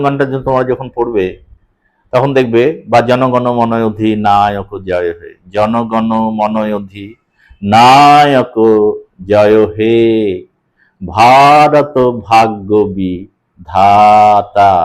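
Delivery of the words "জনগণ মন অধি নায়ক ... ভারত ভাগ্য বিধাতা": slow, syllables stretched in places; slow metrical reading of the anthem; drawn-out recitation. Shown as a demonstration. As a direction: stretch the vowels to show the meter. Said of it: "যেখানে যেখানে আমি একটু টেনে বলছি".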